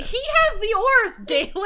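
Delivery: very high, loud, incredulous protest; laughing outcry. Real, not acted. Player protesting Galen's move with the oars, real laughing shout.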